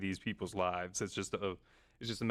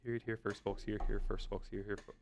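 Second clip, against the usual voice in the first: low, rapid-fire repeats; quick, quieter run of a tongue twister; tongue-twister fast; 3 semitones lower, 4 dB quieter than usual. Shown as a demonstration. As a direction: rattle it off five times fast. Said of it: "Try saying that five times fast".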